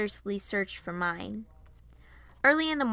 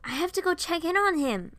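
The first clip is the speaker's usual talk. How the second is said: raised, stammered exclamation; sudden alarm; 8 semitones higher, 5 dB louder than usual. A narrator's reading. Piper suddenly remembers her own dad, alarmed. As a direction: blurt it out higher and louder. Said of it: "I then suddenly remembered".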